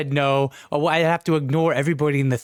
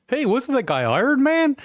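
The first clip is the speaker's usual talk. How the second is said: high mock-puzzled voice; 12 semitones higher than usual. Voicing audiences' question.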